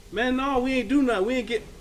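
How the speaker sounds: high, loud, upset protest. Retold or imitated. Friend protesting to police.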